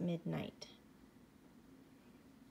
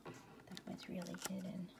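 whisper; 2 semitones lower, 8 dB quieter, much faster than usual. Real, not acted. Hushed aside to child.

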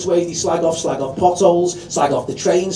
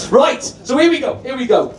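ordinary talk, nothing marked

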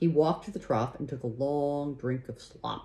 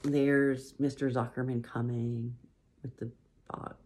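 ordinary delivery

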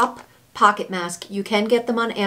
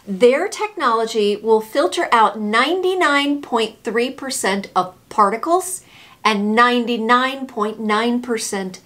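usual speaking voice

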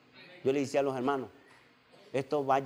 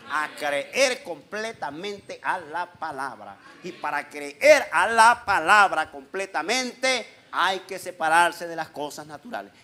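loud, emphatic preacher's exhortation; full-force preaching; 3 semitones higher, 7 dB louder than usual. Real, not acted. Pastor exhorting the congregation to believe the word, preaching forcefully. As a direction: drive each phrase home with raised voice.

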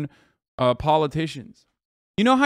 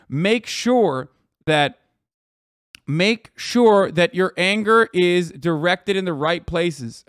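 slow emphatic insistence; much slower than usual. Real, not acted. Urging protest organizers.

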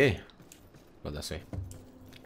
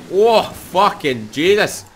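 higher, louder, drawn-out slowly; amazed exclaim; 9 semitones higher, 9 dB louder, much slower than usual. Real, not acted. Gamer stunned by the ultimate, slow astonished cry. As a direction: gasp at the damage.

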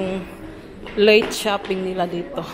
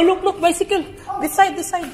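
excited pointing; 6 semitones higher than usual. Real, not acted. Mother showing kids a bicycle, excited. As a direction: point it out eagerly.

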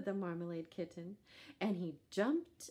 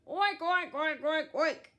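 loud, high oinks; pig oinking; 9 semitones higher, 10 dB louder than usual. A narrator's reading. Pig answering the kitten with loud oinks. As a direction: grunt out the oinks like a big pig. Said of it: "And the pig went".

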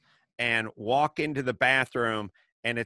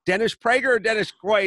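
"Prager" and "Quaid" set against each other, a touch higher and louder; pointed question; 7 semitones higher, 6 dB louder, faster than usual. Retold or imitated. Host springing the name mix-up on his producer. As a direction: lean on Prager, then Quaid. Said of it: "And then I go".

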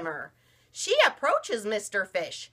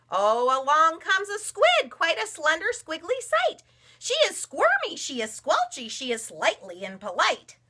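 bright, higher storybook narration climbing on squiggly words; playful read-aloud narration; 8 semitones higher, 3 dB louder than usual. A narrator's reading. Mother reading a picture book to her daughter. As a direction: bounce up on squirmy and squelchy.

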